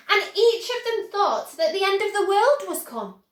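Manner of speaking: ordinary delivery, nothing marked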